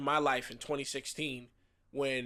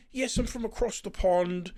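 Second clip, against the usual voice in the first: put-on British accent; 5 semitones higher, 4 dB louder than usual. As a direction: imitate a higher-pitched put-on British accent. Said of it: "I'm British".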